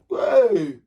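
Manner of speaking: sudden high loud whoa; startled by her own cough; surprised whoa. Real, not acted. Surprised after coughing fit.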